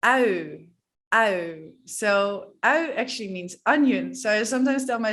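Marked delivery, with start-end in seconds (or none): 0.0-1.7 au as Dutch ui diphthong. Shown as a demonstration. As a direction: open mouth like eating an onion. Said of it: "quite a difficult one".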